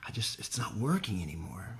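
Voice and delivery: hushed, breathy inner voice; whispered thought. Retold or imitated. Recalling a lonely morning, whispering to himself. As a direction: whisper it to yourself at the window.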